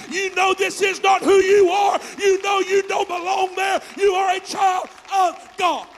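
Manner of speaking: high, loud urgent shout; fervent plea. Retold or imitated. Preacher pleading with a lost sibling, shouting fervently. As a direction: call them back with fire. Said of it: "a detour and say".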